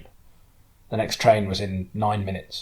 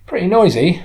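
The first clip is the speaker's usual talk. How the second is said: a higher, louder touch of the driver; lightly voiced woman driver, frank; 10 semitones higher, 10 dB louder than usual. Local driver giving her view of London, lightly voiced, frank.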